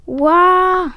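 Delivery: loud, high drawn-out scream; storybook scream. A narrator's reading. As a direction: scream as if flung through the air. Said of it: "schrie Otto".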